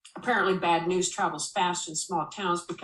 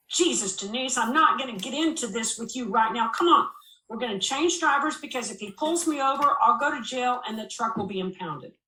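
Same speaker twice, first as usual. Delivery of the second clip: louder, higher, exasperated urgency; urgent exasperated; 5 semitones higher, 5 dB louder than usual. Acted. David snaps at Denise to switch drivers, loud and urgent. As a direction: push her hard, he's out of patience.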